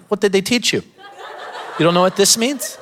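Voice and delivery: high, incredulous mock outrage. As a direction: squeak it in teasing disbelief. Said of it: "I'm like".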